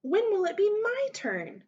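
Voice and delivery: put-on high-pitched childish whine; sulky storybook complaint, pitch way up; pouting. A narrator's reading. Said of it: "pouted her lips".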